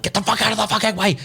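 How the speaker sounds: high, fast, mock-furious screaming at a driver; retold furious yell. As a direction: hurl it like road rage, half-acted. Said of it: "and I'm screaming at this fucker".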